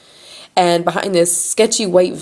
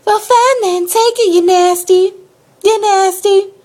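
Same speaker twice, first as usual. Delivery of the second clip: high, loud country lady impression; yelled country accent; 14 semitones higher, 5 dB louder, slower than usual. Storyteller replays tow lady's insult, loud country-accent yell. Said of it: "Let's go back. It was like".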